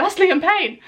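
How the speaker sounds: high-pitched fan outburst; starstruck excitement. Acted. Fan spotting [name] walking down the street, starstruck excitement. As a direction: cry out as if spotting your idol. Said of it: "if that was me and I saw [name]".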